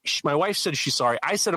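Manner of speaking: ordinary talk, nothing marked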